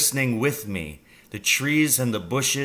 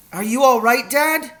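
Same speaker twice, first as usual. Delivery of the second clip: high, raised worried question; anxious calling down; 13 semitones higher, 6 dB louder than usual. A narrator's reading. Boy checks on his father in the pit, calling anxiously. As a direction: call down to check on someone.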